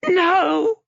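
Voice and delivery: high, loud scream; girl screaming with her ears covered. A narrator's reading. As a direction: shriek it out. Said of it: "She screamed and covered her ears".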